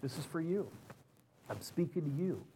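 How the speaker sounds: tender, close, hushed divine voice; gentle voice of God. Retold or imitated. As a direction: speak as God quietly confiding. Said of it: "and God said".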